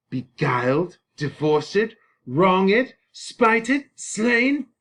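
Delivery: a bereaved suitor escalating his cries; anguished Paris. Acted. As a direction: pile up the grievances in mounting outrage. Reads Paris's lament, string of adjectives.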